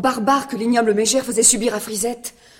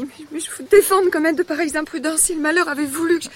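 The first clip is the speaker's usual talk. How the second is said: upset scolding; 7 semitones higher, 6 dB louder, faster than usual. Acted. Scolding the child after scare.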